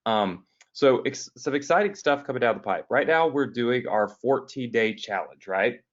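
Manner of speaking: ordinary talk, nothing marked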